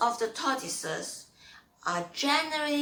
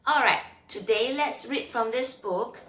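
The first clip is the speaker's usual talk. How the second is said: put-on 1 story reading for kids; 6 dB louder than usual. Reads a story to children.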